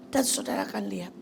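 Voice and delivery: ordinary talk, nothing marked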